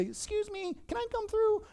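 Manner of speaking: tiny high-pitched little-man voice; wee little man. Acted. Wee little man asking the crowd to let him through, very high. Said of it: "this wee little man can say".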